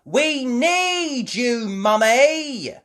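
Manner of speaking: high, loud, drawn-out plea; child's shout. Acted. Child needing Mummy's help, shouting. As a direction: yell slowly up to Mum. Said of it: "shouted Peppa".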